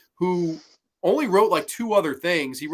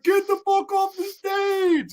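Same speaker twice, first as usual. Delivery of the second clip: mock panicked yell; slower than usual. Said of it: "Everyone's like".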